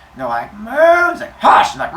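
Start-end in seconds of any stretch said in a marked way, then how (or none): 0.5-1.1 loud high moo; noisy, pitched-up cow reply. Acted. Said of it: "they're all mooing at each other". 1.4-1.7 loud, sharp shush; forceful mock hush; emphatic hushing. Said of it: "he tells all the cows to be quiet".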